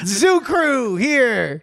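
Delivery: very slow, dragged out; slow title drop. Acted. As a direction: draw out each word proudly.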